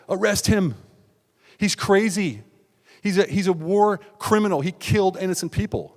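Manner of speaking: imitated hysterical accuser; frantic accusation. Girlfriend accusing him to police.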